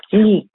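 "Li" voiced as a gentle palatal "gli"; the "Li" as a soft Italian "gli", barely marked. Shown as a demonstration. Teacher modelling the gli sound for a confused student, softly. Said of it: "Con que digáis li, pero sabiendo que es gli".

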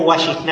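"Washington" said as Cádiz-style "washisnai"; local phonetic adaptation. Shown as a demonstration. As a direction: say "Washington" as "wa-shis-NAI". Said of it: "Son adaptaciones fonéticas".

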